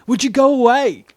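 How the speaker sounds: high, loud, irritated yell at the pest; annoyed shout. Retold or imitated. Woken friend yelling at the narrator to leave. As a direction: snap at him to go away.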